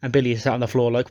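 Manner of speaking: ordinary talk, nothing marked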